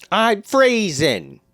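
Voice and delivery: high, slow whine; whiny complaint. Pretend. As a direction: whine about the cold. Acting out dad scene.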